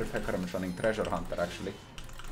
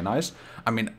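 usual speaking voice

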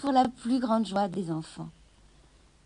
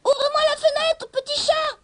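goblin voice; 6 dB louder than usual. A narrator's reading. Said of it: "dit le lutin".

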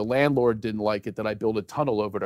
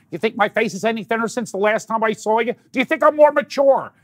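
high, loud local-character voice; Hank; 11 semitones higher, 5 dB louder than usual. Acted. Comedian imitating the local guy from his hometown, high Hank impression. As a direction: pitch it way up like an excitable neighborhood oddball. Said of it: "the local Flower Town guy, Hank".

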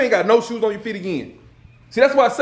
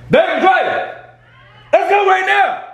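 loud barking wake-up at the door; mock boss yelling the kids awake; shouting wake-up call; 9 semitones higher, 3 dB louder, slower than usual. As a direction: yell them out of bed. Dad waking kids with prank.

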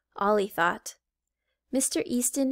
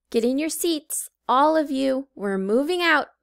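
put-on louder teacher ordering kids; calling out; 4 semitones higher, 5 dB louder than usual. A narrator's reading. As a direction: order the class to sit. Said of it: "he called".

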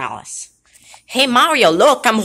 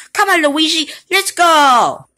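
higher, louder come on, let's go; eager rallying call; 8 semitones higher, 5 dB louder than usual. Acted. Mario urging Luigi to go sue, higher and louder. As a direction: pull him out the door with energy.

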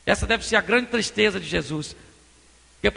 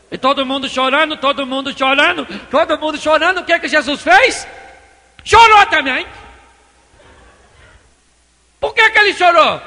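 building to a high, loud shout; preacher's rising full-voice cry; impassioned preaching; 5 semitones higher, 9 dB louder than usual. Real, not acted. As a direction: drive the repetition up to a climax.

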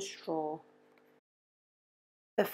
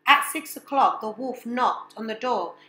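usual speaking voice

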